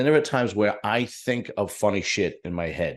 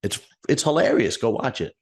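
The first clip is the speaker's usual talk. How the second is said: a retold quick defensive no, pitched up high; protesting it's funny; 7 semitones higher than usual. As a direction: insist it's hilarious, starting high and excited then settling. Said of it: "And I was like".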